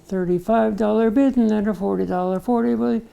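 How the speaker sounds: slow, deliberate auctioneer chant; slow motion. Retold or imitated. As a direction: spell it out word by word. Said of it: "in slow motion".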